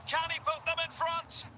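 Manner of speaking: high, urgent commentary question; excited anticipation. Real, not acted. Commentator watching a shot to take the lead, excited. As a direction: pitch leaps up in hope of a goal.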